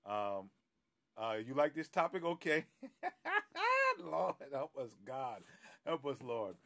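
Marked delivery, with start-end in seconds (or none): 2.7-4.0 loud high burst of laughter. Real, not acted. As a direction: laugh out at the topic. Reacting to a touchy topic.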